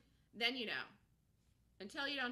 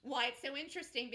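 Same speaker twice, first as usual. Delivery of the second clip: ordinary delivery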